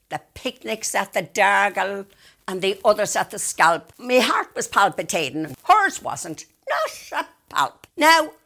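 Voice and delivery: usual speaking voice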